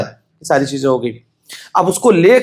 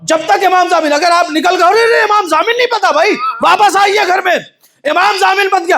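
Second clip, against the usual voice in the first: full-force preaching; 10 semitones higher than usual. Acted. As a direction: call it out like an alarmed family.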